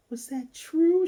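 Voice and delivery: put-on very high, girlish question; high-pitched mock astonishment; imitated touched disbelief. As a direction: act out a moved little girl asking. Voices Becky, moved by the news.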